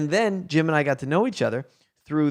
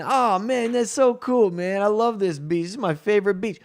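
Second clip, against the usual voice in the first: higher, thrilled fan voice; a high fan gushes over a photo, higher; excited stoner fan; 6 semitones higher than usual. Retold or imitated. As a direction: gush like a starstruck stoner.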